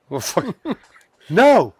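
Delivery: slow, laughing no, half amused; laughing refusal. Retold or imitated. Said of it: "And I'm like".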